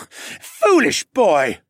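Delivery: loud, high, very slow scorn; contemptuous snort. A narrator's reading. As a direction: sneer it down at him. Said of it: "he snorted".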